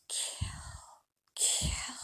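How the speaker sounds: eerie drawn-out whisper; strange voice. A narrator's reading. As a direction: whisper it like a disembodied killer. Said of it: "I had a strange voice".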